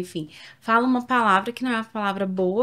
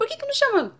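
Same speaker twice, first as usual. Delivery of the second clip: excited question; faster than usual. Real, not acted.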